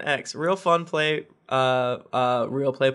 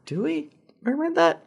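much higher, incredulous; surprised question; 11 semitones higher than usual. Real, not acted. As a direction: ask it in disbelief. Doubting aside to co-host.

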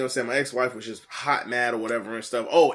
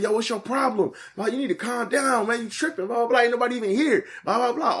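pitched-up, indignant retelling; retold protesting; 10 semitones higher than usual. Him telling his ex through the glass to calm down, retold protest. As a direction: plead your case, voice raised in pitch.

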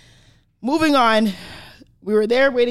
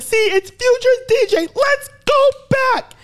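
much higher, louder triumphant call; excited friend; 4 dB louder than usual. Retold or imitated. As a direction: announce it gleefully. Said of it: "and it's like".

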